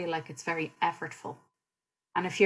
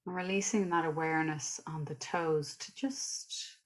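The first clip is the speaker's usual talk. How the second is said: guided meditation; 5 dB quieter than usual. Real, not acted. Teacher leading listeners through a body scan, calm guided meditation. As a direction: guide them gently through the body, unhurried.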